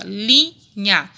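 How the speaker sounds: LINHA split into "li" and "nha"; syllable by syllable. Shown as a demonstration. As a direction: break "linha" between its two syllables. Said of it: "Quantas sílabas tem?". ("linha" separada em sílabas: li, nha)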